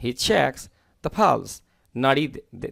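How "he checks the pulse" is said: slow clear model phrase. Shown as a demonstration. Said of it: "repeats model phrase slowly".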